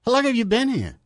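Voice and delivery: mock Cockney, raising the voice; attempted Cockney accent. Shown as a demonstration. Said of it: "Let me see if I can do it".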